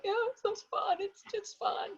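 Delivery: high, tearful voice trying to sound fine; holding back tears. Retold or imitated. Mother hiding tears while telling her son it's fine. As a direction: force a bright answer through tears. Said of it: "I just had tears running down my face".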